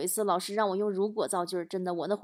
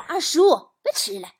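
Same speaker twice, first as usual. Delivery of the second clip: bossy scolding; 6 semitones higher than usual. Retold or imitated. Child scolds younger uncle before teacher, mock bossiness. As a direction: nag a small child to mind his manners.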